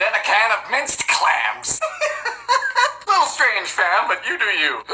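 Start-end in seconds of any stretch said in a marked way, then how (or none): 1.8-3.0 very high giggle; high squealing laugh. Real, not acted. Baker laughing at minced clams, high squealing laugh. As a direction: laugh far above your speaking pitch.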